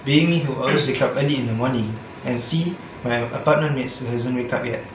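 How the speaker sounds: usual speaking voice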